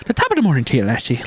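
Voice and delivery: lilting Irish greeting; light stage-Irish hello; Irish accent. Retold or imitated. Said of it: "type of sound Scottish people do".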